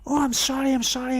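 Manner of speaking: dad apologising to nurse, high mock sheepish voice; imitating dad apologising. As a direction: squeak out dad's sorry like a told-off kid. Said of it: "And he's like".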